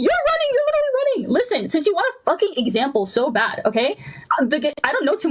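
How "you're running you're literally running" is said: shrill, pitch jumping way up; exasperated squeal. Real, not acted. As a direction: squeal it out in disbelief. Heated debate, sudden squeal.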